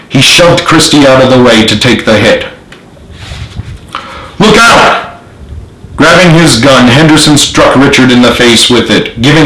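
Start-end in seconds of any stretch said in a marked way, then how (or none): 4.4-5.2 warning shout. A narrator's reading. Warning call in fight.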